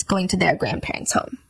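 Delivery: whispered aside, voice dropped; unvoiced under-the-breath remark. Real, not acted.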